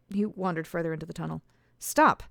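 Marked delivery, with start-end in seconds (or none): 1.8-2.2 high, loud stop; sharp command. A narrator's reading. Alice orders the stranger to stop, sharp and loud. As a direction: halt him on the spot.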